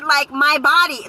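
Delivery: usual speaking voice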